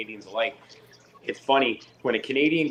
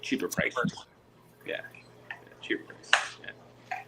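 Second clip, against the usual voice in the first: whispered correction; 2 semitones higher, 7 dB quieter, slower than usual. Real, not acted.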